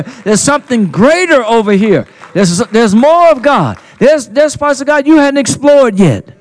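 loud, high-pitched sermon climax; rousing shouted preaching; full-force preaching. Real, not acted. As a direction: preach it out at full power, driving the crowd on.

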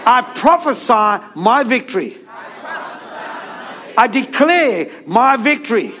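emphatic declaration, building in pitch; like you mean it. Shown as a demonstration. Preacher leading the congregation in declaring victory, like you mean it. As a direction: proclaim it phrase by phrase for others to repeat. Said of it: "say it like you mean it".

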